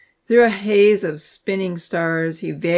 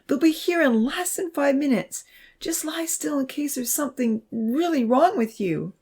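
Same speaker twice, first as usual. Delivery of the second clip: high-pitched worried plea; anxious, high and insistent; begging; 9 semitones higher than usual. A narrator's reading. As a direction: beg him to lie still. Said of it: "she begged".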